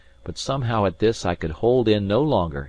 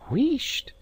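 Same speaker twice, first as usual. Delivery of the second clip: hushing. Acted. Maid hushing in alarm.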